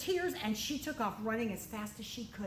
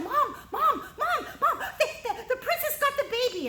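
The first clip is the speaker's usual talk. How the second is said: breathless, laughing, much higher and louder; excited; 12 semitones higher, 7 dB louder than usual. Acted. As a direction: blurt it out tripping over words. Sister bursts in with news.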